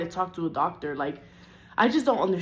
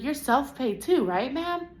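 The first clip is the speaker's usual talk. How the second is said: high, slow, prim mock of the receptionist; mock receptionist voice, high and drawn out; 7 semitones higher, slower than usual. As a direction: parrot her sugary question, pitched up high. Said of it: "she's like".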